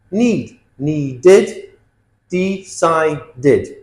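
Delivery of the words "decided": "decided" split as de-ci-did; three syllables. Shown as a demonstration. Teacher counts syllables, "decided" in three separate beats. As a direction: sound out each syllable of "decided". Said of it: "Three syllables".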